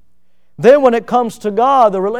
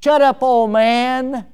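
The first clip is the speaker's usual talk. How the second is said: slow, higher sneering put-down; mock rude youth; 6 semitones higher, slower than usual. Preacher mimics a rude youth to an elder, sneering.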